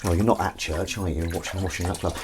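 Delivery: normal talking voice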